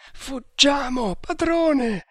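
very high, drawn-out wail of panic; terrified servant crying out; mad with terror. A narrator's reading. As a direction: beg to flee, stretching the words high. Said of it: "pazzo di terrore".

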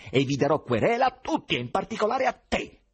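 high, loud, heated threat; agitated. A narrator's reading. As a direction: threaten them all, worked up. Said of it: "disse eccitato Ivan". (eccitato, voce alta e acuta)

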